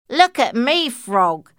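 high, loud call. Acted. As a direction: call out to the frog. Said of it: "called the frilled lizard".

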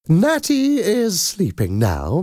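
put-on high, slow, sweet old nanny; kindly nanny voice. A narrator's reading. Madam Doubtfire voiced.

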